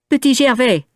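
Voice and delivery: put-on high bright child voice; boy's voice. A narrator's reading. Boy telling Valjean his name, childlike. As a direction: answer like a trusting little boy.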